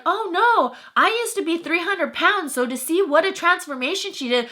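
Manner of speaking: high-pitched imitation of hasty denial; mocking backpedaler. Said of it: "you should have seen his tone of voice".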